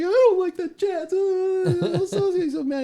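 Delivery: put-on high, tearful little boy's wail; crying kid. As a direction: imitate a kid sobbing over his traded hero. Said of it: "starts crying".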